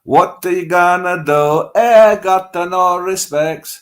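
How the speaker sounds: slow, hammed-up Italian complaint; drawn-out mock-Italian patter; Italian accent. Shown as a demonstration. Asked to be Italian, slow Italian accent. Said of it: "you're Italian".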